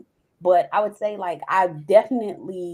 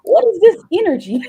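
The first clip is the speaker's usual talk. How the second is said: laughing; 13 semitones higher, 5 dB louder than usual. Retold or imitated. Her reaction to the therapist's praise.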